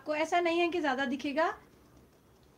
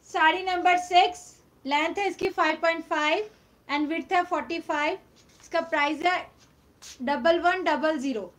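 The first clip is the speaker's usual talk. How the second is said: तेज़ बोलना; 6 dB louder than usual. Real, not acted. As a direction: call out the price to every viewer. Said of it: "मैं तो बहुत तेज बोल रही हूँ". (ज़ोर से, ऊँची आवाज़ में साड़ी का नंबर और कीमत)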